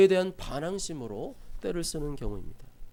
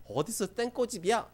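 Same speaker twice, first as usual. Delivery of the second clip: mock peer scolding; 6 semitones higher than usual. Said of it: "막 이러면서".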